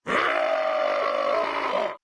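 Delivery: long, low agonized groan; drawn-out "ah" of pain; agonized cry. Acted. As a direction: groan it out at length. Character's dying cry.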